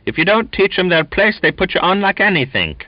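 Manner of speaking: high, fast sneering sailor. Acted. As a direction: spit the contempt out quickly. Donkin sneers about foreigners.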